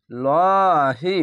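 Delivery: লহি held very long with a full heavy ল; heavy stretched lahi. Shown as a demonstration. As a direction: chant লহি slowly, thick and drawn out. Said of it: "আল্লাহ লেখাটি মোটা করা হবে". (মোটা করে টেনে পড়া)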